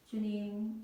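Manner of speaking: ordinary delivery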